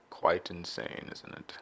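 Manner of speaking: quiet, mock-refined aside; mock posh self-talk. Lightly voiced posh self-talk to himself, quiet. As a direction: talk to yourself in a hushed posh way. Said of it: "I talk to myself a lot".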